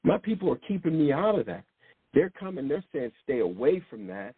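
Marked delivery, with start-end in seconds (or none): none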